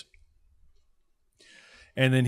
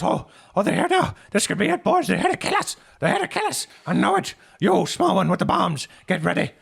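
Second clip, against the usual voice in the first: very high, loud panicked voice; terrified he's about to be killed; panicked innkeeper; 9 semitones higher, 7 dB louder than usual. Acted. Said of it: "he starts sweating".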